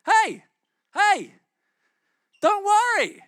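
high, loud, breezy reassurance; casual laid-back Jesus. Retold or imitated. Preacher imagining himself as Jesus calming mourners, high and breezy. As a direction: play a chill bro telling everyone to relax. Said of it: "I'd be like".

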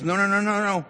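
acted interrupting objection; mock cutting off. Therapist cuts the client off.

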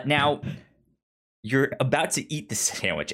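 usual speaking voice

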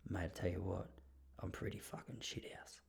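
low, very soft near-whisper; hushed confession. Retold or imitated. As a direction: confide it under your breath to a mate. Retells man's hushed confession.

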